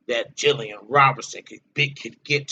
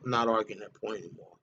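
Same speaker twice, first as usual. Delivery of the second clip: quiet, fast aside; 6 semitones lower, 11 dB quieter, faster than usual. Real, not acted. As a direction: drop low and soft, rattling it off to himself. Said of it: "I've argued that point for long enough".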